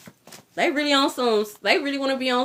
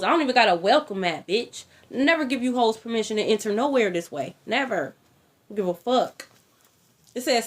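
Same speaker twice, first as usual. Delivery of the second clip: ordinary talk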